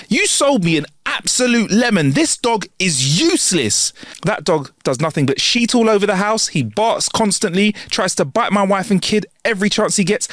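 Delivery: high-pitched indignant complaint; angry rant. Acted. Regular screams at the man who sold him the dog, angry rant. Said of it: "he screams at the guy".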